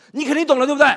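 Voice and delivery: loud, high demand; insistent. Acted. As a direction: push the friend hard for agreement. Pressing friend to understand him.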